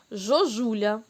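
жожуля with zh in place of the first z; wrong sound on purpose. Shown as a demonstration. Teacher shows the children the wrong word жожуля, with zh. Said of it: "Правильно це?".

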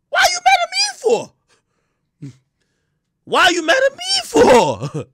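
very high loud shouted question; high mock shout. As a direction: yell it up high in mock outrage. Shouting at angry fans.

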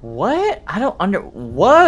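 high-pitched bewilderment; baffled. Real, not acted. Player seeing a strange sight in game, high baffled surprise.

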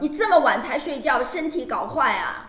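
scolding mother. Retold or imitated. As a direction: yell at the kid to sleep. Interpreter voices scolding mother.

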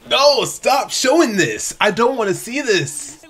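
high, slow, loud wail of protest; distressed protest. Real, not acted. Viewer begging the show to stop showing a scene, distressed. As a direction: plead with the screen, drawing words out.

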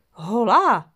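loud, glad call of surprise; joyful shout. A narrator's reading. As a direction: shout it out with delight. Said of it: "zvolal voska radostně".

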